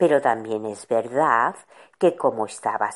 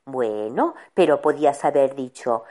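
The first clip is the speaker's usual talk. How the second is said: usual speaking voice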